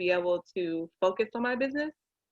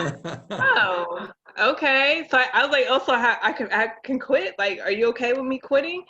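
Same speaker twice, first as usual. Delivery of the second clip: surprised disbelief; 4 semitones higher, 4 dB louder than usual. Retold or imitated. Surprised reply to husband.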